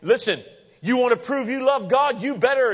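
high, loud mock lecture; hammed-up forceful demand; demanding preachers. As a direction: lay down the law, mimicking pushy believers. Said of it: "people take that and say".

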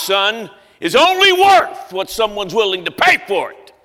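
put-on stubborn old man shouting down the appraisal; angry shouting. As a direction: hammer the point home to the crowd. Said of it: "he yells".

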